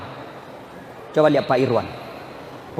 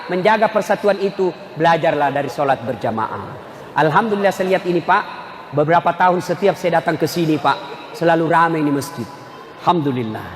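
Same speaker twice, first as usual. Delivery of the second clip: ordinary talk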